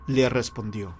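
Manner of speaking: usual speaking voice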